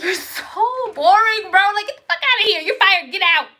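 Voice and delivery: high, loud, hammed-up dismissal; mock irritated rant. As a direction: act out firing him in exasperation. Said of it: "and then I get irritated".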